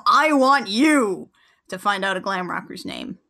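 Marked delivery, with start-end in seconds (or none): 0.1-1.3 slow, louder mock Uncle Sam call; Uncle Sam poster. [name] recruits viewers like Uncle Sam, mock-grand and slow. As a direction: point at the crowd like the Uncle Sam poster, slowly. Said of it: "like, Uncle Sam".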